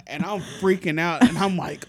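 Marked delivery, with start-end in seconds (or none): none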